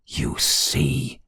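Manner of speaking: low, drawn-out emphasis; vehement exclamation. A narrator's reading. Wife to rector once the maid has gone, vehement exclamation. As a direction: lean on it, pitch dropped. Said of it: "exclaimed Clara".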